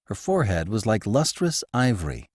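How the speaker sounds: ordinary delivery, nothing marked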